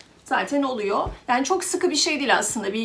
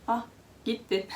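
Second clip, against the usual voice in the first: ordinary delivery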